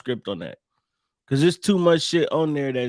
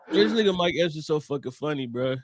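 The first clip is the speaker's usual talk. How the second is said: ordinary talk, nothing marked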